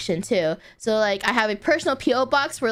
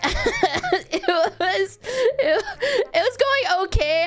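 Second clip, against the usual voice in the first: laughing; 9 semitones higher, slower than usual. Real, not acted. Breaks up laughing mid-story.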